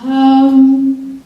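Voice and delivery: high, hesitant evasive voice; awkward dodge. Retold or imitated. Dodging Russ's question about Molly, high and awkward. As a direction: squeak it out, not wanting to answer. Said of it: "And I was like".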